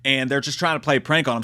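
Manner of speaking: ordinary delivery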